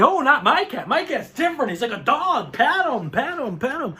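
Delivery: eager mock cat-owner voice. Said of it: "they all have the same reaction".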